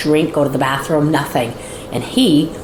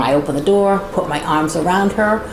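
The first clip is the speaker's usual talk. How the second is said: ordinary delivery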